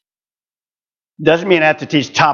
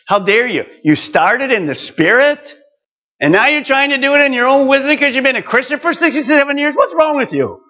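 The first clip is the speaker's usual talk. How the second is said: rising into a much higher indignant rebuke; Paul scolding; 11 semitones higher than usual. Retold or imitated. Preacher voicing Paul rebuking the Galatians, high indignant scold. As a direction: scold them like an exasperated apostle, climbing in pitch. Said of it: "And Paul says".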